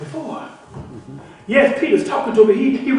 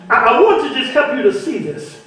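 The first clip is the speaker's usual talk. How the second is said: ordinary talk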